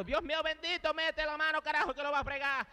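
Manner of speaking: high, urgent cry for someone to step in; alarmed plea. Retold or imitated. Narrator begging the crowd to stop the throw, high alarmed plea.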